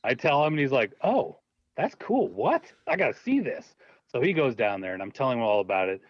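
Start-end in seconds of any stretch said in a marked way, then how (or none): 1.0-3.7 high, eager and delighted; thrilled repairman. Retold or imitated. A repairman, shown the basement cave, answering the host, higher and eager. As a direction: gush like a fan who just found out.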